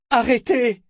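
high, loud shout. Acted. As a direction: yell it out to stop them. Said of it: "cria-t-elle".

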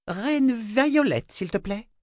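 very high, slow, imperious; haughty. A narrator's reading. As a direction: correct her with icy pride. Haughty correction of title.

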